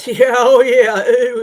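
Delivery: loud, slow, laughing yeahs; laughing agreement. Real, not acted.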